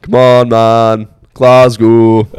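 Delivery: slow, loud drawn-out Glaswegian; Glaswegian accent. Retold or imitated. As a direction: drag it out in a broad Glaswegian accent. Mimicking Glaswegian.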